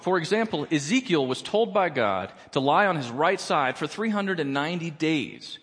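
usual speaking voice

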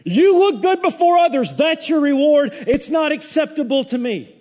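loud, high, scornful preacher's blast; preaching at full force. Acted. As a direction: thunder the rebuke from the pulpit. Preacher rails at hollow worship.

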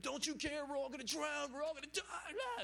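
very high-pitched panicked impression; mock freaking out. Disciples waking Jesus in the storm, high mock panic. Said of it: "And they're freaking out".

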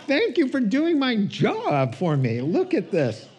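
high, loud laughing thanks; mock thanks. Pastor laughing his mock thanks to the storyteller. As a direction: gush a joking thank-you through a laugh.

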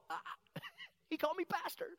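high, giddy laughing disbelief; giddy excitement. Retold or imitated. Pastor starstruck that Billy Graham called him pastor, giddy laugh. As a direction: squeal it like a starstruck fan.

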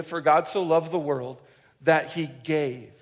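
"that he gave" slowed right down, falling on "gave"; stress on gave. Shown as a demonstration. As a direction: lean into "he gave", slow and heavy. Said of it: "let me show this to you".

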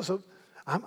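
usual speaking voice

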